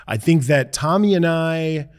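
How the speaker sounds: normal talking voice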